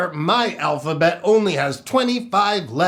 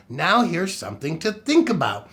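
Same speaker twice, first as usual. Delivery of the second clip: usual speaking voice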